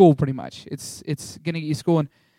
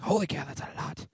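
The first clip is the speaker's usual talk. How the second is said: much softer, stunned reaction; hushed, awed mock dismay; hushed shock; 11 dB quieter than usual. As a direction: say it under your breath, taken aback. Reacting to the price.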